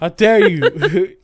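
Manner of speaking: slow, laughing mock indignation; mock offended while laughing; laughing mock outrage. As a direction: stretch it out through a laugh. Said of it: "How dare you try and save my life?".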